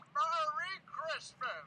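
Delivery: loud, high, very slow merry Christmas; joyful greeting. Acted. Reformed Scrooge showing how he should be, loud joyful cheer. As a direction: belt it out with glee.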